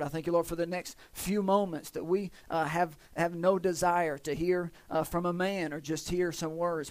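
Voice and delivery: soft, low-toned prayer. Real, not acted. Preacher praying to Father God before the message, soft and low.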